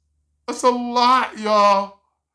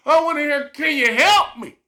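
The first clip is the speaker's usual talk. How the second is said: laughing plea; 6 semitones higher, 4 dB louder than usual. Real, not acted. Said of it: "wanting to be helped".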